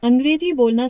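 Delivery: ordinary delivery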